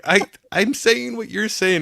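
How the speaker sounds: mock protest. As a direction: retell it as a slow, insistent protest. Retold protest at being corrected.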